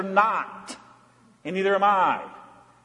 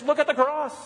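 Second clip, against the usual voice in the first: urgent plea; 8 semitones higher than usual. Acted. Preacher urging congregation.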